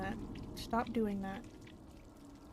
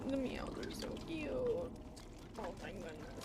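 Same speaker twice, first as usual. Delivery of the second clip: slow and much softer; cooing; 9 dB quieter, slower than usual. Real, not acted. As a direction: melt over something cute. Cooing over cute meows.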